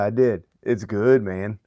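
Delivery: ordinary delivery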